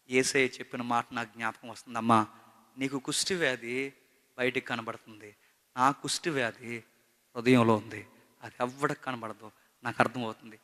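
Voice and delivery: ordinary talk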